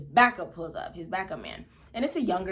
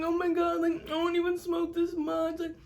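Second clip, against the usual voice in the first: staged tearful wail, much higher; fake crying; 8 semitones higher, 5 dB louder than usual. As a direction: play up the tears to the officer.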